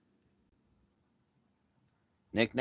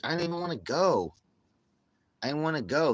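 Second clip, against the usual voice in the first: whiny protest; 5 semitones higher, 4 dB louder than usual. Pretend. Blaming friend when busted.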